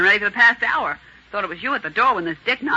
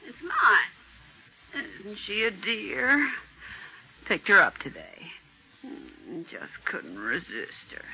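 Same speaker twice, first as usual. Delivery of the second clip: slow, soft fondness; doting; 8 dB quieter, much slower than usual. Acted. Woman showing off the cat she just picked up, soft and doting. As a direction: linger softly, adoring the pet.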